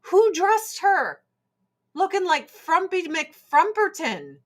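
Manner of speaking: high-pitched, incredulous mock outrage; indignant disbelief. Viewer appalled by Lois Lane's outfit, incredulous. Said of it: "And I'm like".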